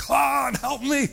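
high, loud shout for help; acted panicked scream to God; desperate crying out. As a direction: yell it like a drowning man. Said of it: "He cried out and said".

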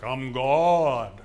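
loud mock booming voice of God. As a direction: boom it out like a voice from the heavens. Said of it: "booming voice like".

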